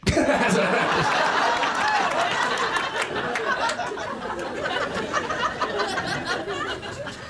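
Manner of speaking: real, high-pitched drawn-out laugh; high cackle of a laugh; high long laugh. Real, not acted. Long laugh after punchline.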